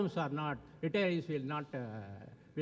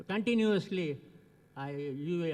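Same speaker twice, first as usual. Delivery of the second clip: ordinary talk, nothing marked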